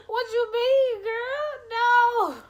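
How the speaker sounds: very high, loud squeal of disbelief; shocked disbelief. Retold or imitated. Teller acts out hearing friend's confession, high shocked squeal. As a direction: squeal it in mock horror.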